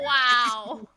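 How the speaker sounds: loud, drawn-out disbelief; offended wow. Real, not acted. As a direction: stretch it out, loudly affronted. Reacts to co-host's snub.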